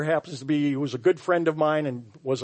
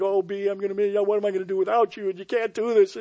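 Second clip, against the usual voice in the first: quick, higher, lightly voiced crying plea; mild mock sob of a husband begging; retold tearful plea; 5 semitones higher, faster than usual. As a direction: imitate a grieving husband, rushed and pleading. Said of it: "he's crying".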